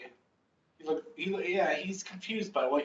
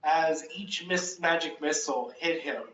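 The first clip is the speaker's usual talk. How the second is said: ordinary talk, nothing marked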